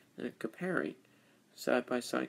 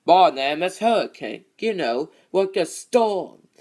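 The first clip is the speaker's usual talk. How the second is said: Hurricane voice; 3 semitones higher, 11 dB louder than usual. Retold or imitated. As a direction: bellow it like a big tough engine. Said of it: "I could do a hurricane".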